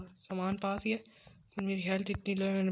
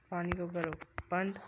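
ordinary delivery

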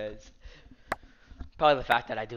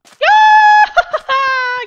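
high shrieking laugh; screaming laugh; 15 dB louder than usual. Real, not acted. Wild laugh after kill.

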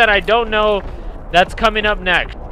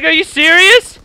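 high, loud incredulous question; mock disbelief; 8 semitones higher, 8 dB louder than usual. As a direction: act out the confused guy's shock. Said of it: "He's like".

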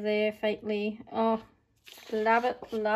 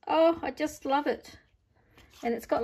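ordinary talk, nothing marked

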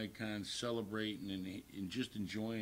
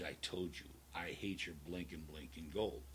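low menacing repeat; 5 semitones lower, 8 dB quieter than usual. Retold or imitated. Stranger repeating his threat to Limo Bob, low and cold. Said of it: "He goes".

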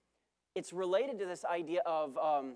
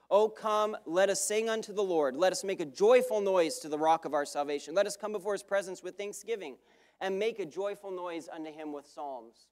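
louder, ringing proclamation of the psalm; scripture reading; 5 dB louder than usual. Acted. Preacher reading Psalm ninety-five aloud to the church, proclaimed. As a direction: call the congregation to sing out.

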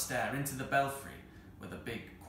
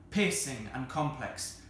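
plain reading voice

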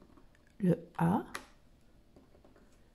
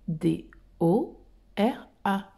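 slow spelling; 4 semitones lower, 4 dB quieter, slower than usual. Shown as a demonstration. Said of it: "Donc, Dora".

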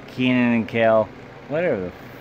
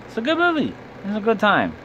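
high squeaky voice; 11 semitones higher than usual. Acted. Sudden high squeaky line.

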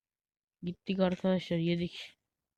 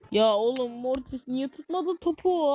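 higher, slow, stretched-out complaint; exasperated whine; 5 semitones higher than usual. Real, not acted. Gamer moaning at teammate Mortis for missing the ball, exasperated whine. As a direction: drag it out in disbelief.

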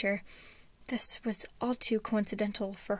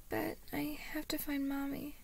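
put-on high, soft little-boy voice; whimpering; 6 semitones higher, 5 dB quieter than usual. A narrator's reading. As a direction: whimper it like a child missing his mother. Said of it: "he whimpered".

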